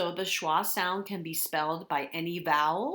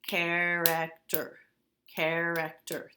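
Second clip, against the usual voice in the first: slow, each syllable pulled apart; slower than usual. Shown as a demonstration. Teacher to third graders, slowly sounding out syllables. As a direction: stretch the word out slowly by syllables. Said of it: "guess how many syllables".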